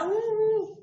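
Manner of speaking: high pretend boo-hoo wail; high playful sobbing ऊ ऊ; mock crying. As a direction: wail like a sad little moon for the children. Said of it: "ऊ, ऊ करते हुए चंदा रो रहा".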